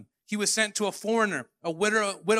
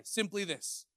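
usual speaking voice

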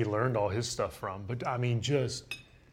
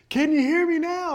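much higher, much louder taunting shout; mocking yell; 6 dB louder than usual. Drunk fan shouting down at the player, taunting him loudly.

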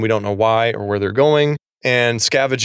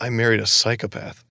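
deadpan dismay; 4 dB quieter than usual. Retold or imitated. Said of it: "And she's like".

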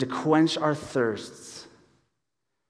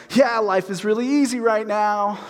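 high, exaggerated sarcasm; sarcastic; 6 semitones higher, 4 dB louder than usual. Pastor retelling his text to a buddy, sarcastic. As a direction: lay the sarcasm on thick, pitched up. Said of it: "I said something real sarcastic".

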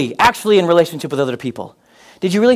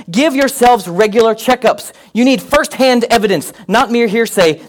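raised, higher preaching read of scripture; 5 semitones higher, 3 dB louder than usual. Acted. Pastor reading Paul's letter to the congregation, raised and proclaiming. Pastor reads Second Corinthians aloud.

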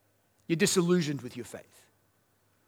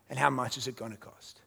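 hushed question; 6 semitones lower, 10 dB quieter than usual. Real, not acted. Pastor asking the church, dropped low and soft. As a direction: let it land quietly.

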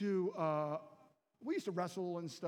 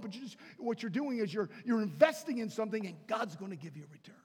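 ordinary talk

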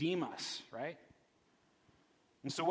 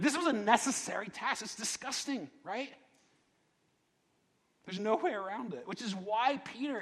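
ordinary talk

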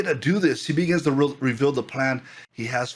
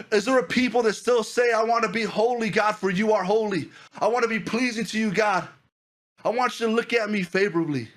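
high, driving preacher's cry to the congregation; fervent preaching; 9 semitones higher than usual. Acted. Pastor urging the young church to pursue God, fervent preaching. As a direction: preach it out with full fire, voice lifted high.

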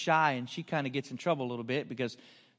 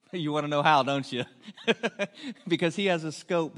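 teasing the crowd through laughter; laughing; 2 semitones higher, 7 dB louder than usual. Real, not acted. Preacher teasing the congregation, laughing loudly. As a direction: laugh as you ask it.

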